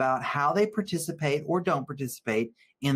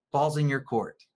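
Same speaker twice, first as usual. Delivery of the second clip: normal talking voice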